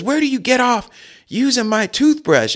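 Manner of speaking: hammed-up angry father; indignant dad. Dad scolds the boys, high mock outrage. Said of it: "He goes".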